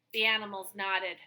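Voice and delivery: plain reading voice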